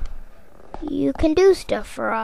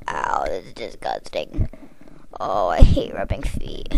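whispered grumble; 5 dB quieter than usual. Acted. Rubbing feet, muttering a whispered complaint. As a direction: grumble it under your breath, unvoiced.

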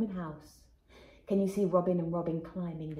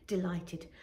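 plain reading voice

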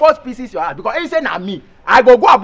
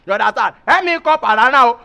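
ordinary delivery

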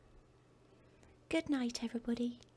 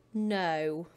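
a louder, lower, blunt no; mock shout; 4 semitones lower, 6 dB louder than usual. Nathan refusing to share, louder no. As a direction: snap the no out at him. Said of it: "shouted Nathan".

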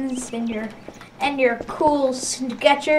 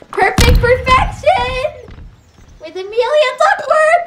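mock announcer; 8 semitones higher than usual.

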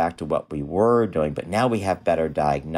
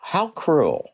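indignant; 10 semitones higher than usual. Real, not acted. Outrage at girl's suffering.

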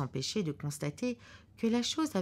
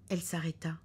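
plain reading voice

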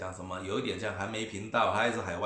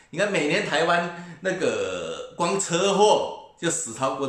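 higher, louder, slow point; emphatic; 7 semitones higher, 7 dB louder, slower than usual. Real, not acted. Arguing with viewers, higher, louder and slow. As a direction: press the comparison hard. Ranting about traffic deaths.

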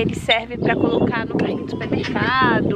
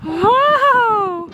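delighted squeals; 10 semitones higher, 4 dB louder, much slower than usual. Real, not acted. Squealing at baby on swing.

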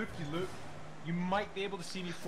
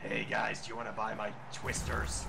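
Fallout ghoul voice; 8 semitones lower than usual. Pretend. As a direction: growl it out like a ghoul. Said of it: "sound like a freaking, uh, ghoul from Fallout four".

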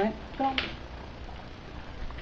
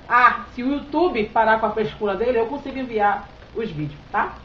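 ordinary talk

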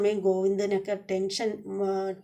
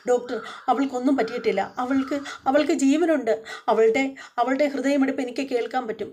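higher and louder, pleading that she is alive; desperate insistence; 5 semitones higher, 4 dB louder than usual. Retold or imitated. Husband insisting to the doctor that she lives, higher and louder. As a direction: push it out like a frantic husband. Govind hears heartbeat, begs doctor.

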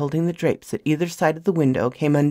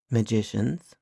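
low and softer, held back; quietly; 4 semitones lower, 6 dB quieter than usual. A narrator's reading. Alex answering the woman quietly, hushed and low. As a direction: say it softly under the breath. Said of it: "he said quietly".

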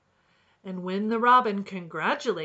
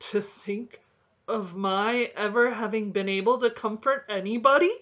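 louder, amazed exclamation; exclaimed; 3 semitones higher, 8 dB louder than usual. A narrator's reading. Woodlark surprised he comforted anyone, exclaiming. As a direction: marvel at your own usefulness. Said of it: "exclaimed the woodlark".

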